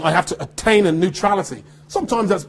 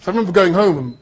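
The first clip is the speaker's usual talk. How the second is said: ordinary delivery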